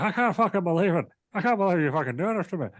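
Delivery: high, hammed-up indignant Irish voice; mock outraged Irishman. Sheamus can't believe Drew's doing this, in a high hammed-up Irish voice. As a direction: sound betrayed and livid, Irish. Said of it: "And Sheamus is like".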